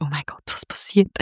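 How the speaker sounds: hushed whisper of mock unease; acted-out insecure aside, barely voiced; unsure, retold. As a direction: whisper it like a band losing its nerve. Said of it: "so mega unsicher geworden".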